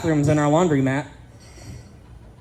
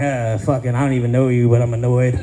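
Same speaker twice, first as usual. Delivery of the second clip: lightly voiced grumpy old man, lower; 6 semitones lower than usual. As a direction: grumble it low like a cranky old-timer. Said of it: "Like, I'm eighty-five when I'm thirty".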